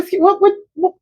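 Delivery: high, fast, incredulous questioning; wife's high, fast disbelief at husband's brush-off; baffled. Retold or imitated. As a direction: sound thrown by the hang-up. Wife baffled by husband on phone.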